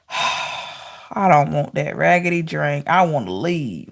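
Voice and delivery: slow, quiet, fed-up refusal; card figure at a party turning down a drink. Pretend. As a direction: wave off the drink like it's beneath you.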